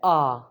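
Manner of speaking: आ as a long open "aah"; Spanish A vowel. Shown as a demonstration. Teacher models first vowel for learners, long open आ. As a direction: say आ as in father. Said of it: "जो sound है, वो है".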